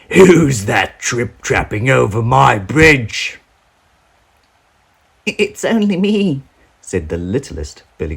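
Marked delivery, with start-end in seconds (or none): 0.1-3.4 louder, slow troll challenge; growling troll. Acted. Troll challenging the goat on his bridge, slow and loud. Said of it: "with a monstrous growl". 5.2-6.4 a tiny goat setting off bravely; littlest billy goat. Acted. Littlest goat announcing he will cross, high and small.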